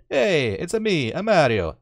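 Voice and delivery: high, loud cartoon Italian accent; Mario. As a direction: belt it out in a mock Italian accent. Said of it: "Now I sound offensive".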